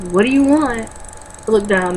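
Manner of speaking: ordinary talk, nothing marked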